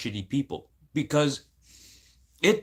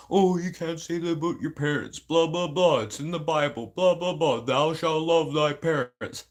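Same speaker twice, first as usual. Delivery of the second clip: mocking preachy scold; 2 semitones higher than usual. Imitating people quoting the Bible about parents, mocking singsong. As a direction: parrot pious critics with contempt.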